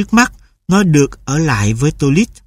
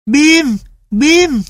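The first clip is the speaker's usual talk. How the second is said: much higher, joyful call; boy cries out; 11 semitones higher than usual. A narrator's reading. Boy calls his lost dog in joy, much higher. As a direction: call the dog's name in delight. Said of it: "Tô Lít kêu lên".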